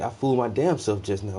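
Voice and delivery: ordinary talk, nothing marked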